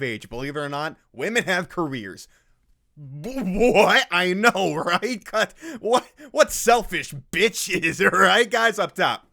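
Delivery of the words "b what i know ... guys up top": high, loud sarcastic outrage; hammed-up incredulous squawk, higher and louder; mock outraged nice guy. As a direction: play the indignant bro cheering his pals. Mocking the nice guy's outrage.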